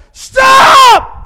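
sharp high yell at the truck; loud commanding shout; shouted stop. Retold or imitated. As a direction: bellow it like a drunk giant. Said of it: "He'll say".

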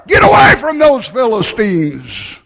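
put-on loud call out the door to the kids; a father hollering at his kids to come away; shouted warning. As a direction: yell it across the yard. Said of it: "he shouted out the door".

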